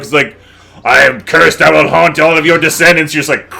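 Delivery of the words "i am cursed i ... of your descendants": mock ominous curse, pushed slightly loud; cursed-object voice. Joking about a cursed ruby haunting descendants, mock ominous voice. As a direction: intone it like a comic curse. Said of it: "such a Family Guy joke".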